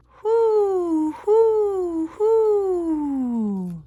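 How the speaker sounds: high, loud, drawn-out hoots; long falling owl calls. Pretend. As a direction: hoot like a calling owl. Imitating a hidden owl calling.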